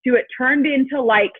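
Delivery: ordinary delivery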